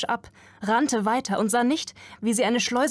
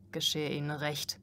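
much lower and softer, a grim mutter; low murmur; 4 semitones lower, 7 dB quieter than usual. A narrator's reading. Another worker muttering grimly about the rich, low murmur. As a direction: murmur it darkly under your breath. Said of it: "raunte ein anderer".

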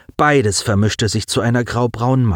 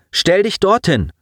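higher commanding voice; character voice; 10 semitones higher than usual. Acted. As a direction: give the order briskly. Colin gives an order.

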